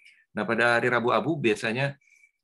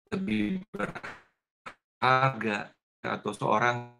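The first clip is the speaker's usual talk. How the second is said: choppy dropping-out connection audio; breaking up space radio; slower than usual. Real, not acted. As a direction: voice cutting in and out. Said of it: "putus, Pak".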